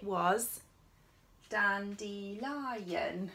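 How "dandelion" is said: dandelion said slowly as dan-de-li-on; slow syllable split. Shown as a demonstration. Teacher slowly splitting dandelion into four syllables for children. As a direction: stretch each syllable of dandelion. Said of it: "four syllables in".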